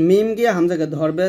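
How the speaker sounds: normal talking voice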